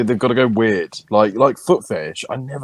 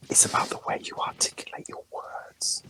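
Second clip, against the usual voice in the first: whispering; 12 dB quieter than usual. Pretend. Keeps whispering.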